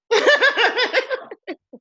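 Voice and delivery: high-pitched burst of laughter. Real, not acted. Laughing at her own joke about representing, high laugh. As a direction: crack up in a high giggle.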